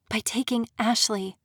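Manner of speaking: ordinary delivery, nothing marked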